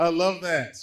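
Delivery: I love that, thrilled and loud; delighted exclaim. Real, not acted. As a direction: cry it out with joy.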